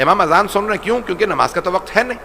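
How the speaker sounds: high, loud climax; rising preaching. Acted. Preacher voicing the Imam halting the muezzin, loud and high. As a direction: build to the cry to stop.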